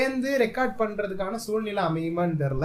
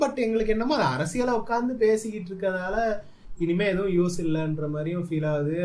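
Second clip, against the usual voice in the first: ordinary talk, nothing marked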